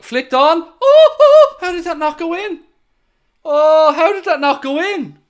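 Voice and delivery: high, loud cries of astonishment; excited disbelief. Real, not acted. Streamer watches a flicked-on chance miss, high-pitched incredulous shouting. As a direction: erupt as the ball somehow stays out. Chance missed in live commentary.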